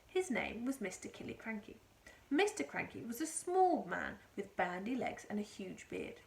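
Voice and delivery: usual speaking voice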